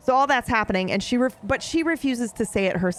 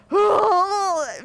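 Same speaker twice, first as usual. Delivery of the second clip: big, high, loud-breathed gasp; overcome gasp; 9 semitones higher, 3 dB louder than usual. Shown as a demonstration. Showing how the book hit the reader, overcome gasp. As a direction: gasp as if struck through the heart. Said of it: "me right now".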